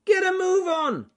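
shrieked, very high impatient shout; Jim Red Squirrel shouting. Acted. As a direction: yell to hurry them up, squeakily. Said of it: "shouted Jim Red Squirrel".

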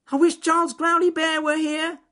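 high, loud yell; rabbit yelling. Acted. Rabbit stuck in the snake's mouth yells for the bear. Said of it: "yelled Ernest Fluffy Rabbit".

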